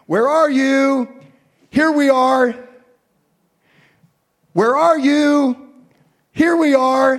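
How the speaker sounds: high, slow, loud sing-song calls; playful call and answer. Acted. God and children playing Marco Polo, loud sing-song.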